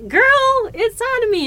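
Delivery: high, loud imitation of the partner; mock exasperation. Imitating the partner explaining where it is, mock exasperation. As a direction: spell it out emphatically, hammed up.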